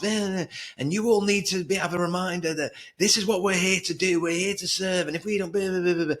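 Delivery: mock a boss losing his rag at the team; boss going off. Boss ranting at his team about expectations, imitated high angry rant. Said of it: "he would just go off on one".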